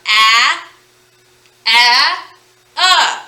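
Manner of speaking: loud, single vowels with pauses between; short A, then UH, held out; isolated vowel sounds. Shown as a demonstration. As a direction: give each vowel sound alone, loud. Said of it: "each hand has one vowel sound".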